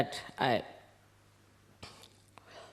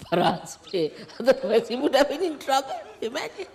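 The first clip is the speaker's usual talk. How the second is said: laughing it out, louder and higher; real amused laughter through the words; chuckling at how it turned out; 8 semitones higher, 5 dB louder than usual. Real, not acted.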